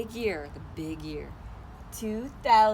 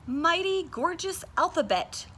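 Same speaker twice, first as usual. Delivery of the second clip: very high, awed, slowed wondrous; marveling bird; 11 semitones higher, 5 dB louder, slower than usual. Acted. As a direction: marvel at each word. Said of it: "she marveled".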